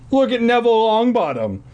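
mock witch. Joke line, boosted voice.

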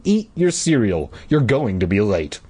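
usual speaking voice

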